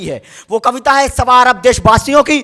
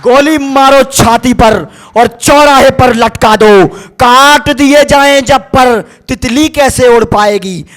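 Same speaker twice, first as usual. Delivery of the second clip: forceful poem recitation, subsiding; 5 dB louder than usual. Acted. Poet asks how daughters will study, forceful recitation falling away. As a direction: start as a rallying cry, then sink for the final question. Repeats verse, then winds down.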